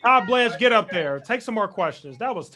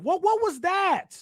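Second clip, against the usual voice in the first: much higher, shouted what was that; exasperated shout; 11 semitones higher than usual. Real, not acted. Streamer shouting at the press conference clip in exasperation. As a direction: yell it in disbelief at the screen.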